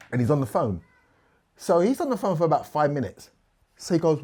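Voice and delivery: ordinary talk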